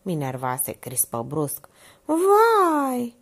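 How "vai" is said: high loud alarmed vai; high frightened cry; startled gasp word. A narrator's reading. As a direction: jump in fright. Minerva suddenly startled.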